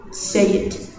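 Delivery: plain reading voice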